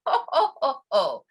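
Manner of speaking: forced ho ho laugh; laughter yoga giggle; giggling demo. Shown as a demonstration. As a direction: laugh as you rub it in. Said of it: "and we giggle".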